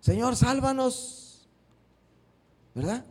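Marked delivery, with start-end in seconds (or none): 0.0-1.5 agudo y muy lento, alargando "sálvanos"; clamor suplicante. Retold or imitated. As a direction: implora estirando cada sílaba. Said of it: "le clamaron a Jesús". (los discípulos claman a Jesús, suplicantes)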